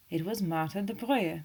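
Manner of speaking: usual speaking voice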